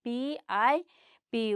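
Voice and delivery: पी आई spelled out, then पी said as one syllable; spelled then sounded. Shown as a demonstration. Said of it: "आई का मात्रा डालेंगे तो हो गया पी". (अक्षर बोलकर फिर पी का उच्चारण)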